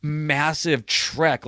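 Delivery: usual speaking voice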